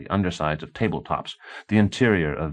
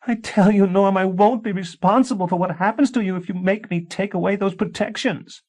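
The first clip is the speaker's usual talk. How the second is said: high, loud urgent warning; heated warning wife; 5 dB louder than usual. A narrator's reading. A wife warning her husband, high and loud. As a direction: threaten him out of fear for him. Tansy voiced, heaving.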